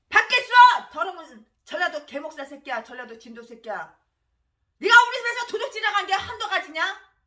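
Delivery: loud, raging insults hurled at a pastor; enraged shouting rant; furious tirade. Real, not acted. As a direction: spit out each insult with real fury. Tirade resumes after the verses.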